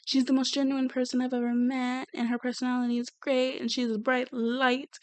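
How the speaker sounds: soft, even imitation; quoted gushing praise. Sal sitting there praising the girl, softly imitated.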